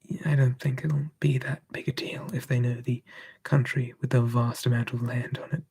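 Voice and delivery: ordinary delivery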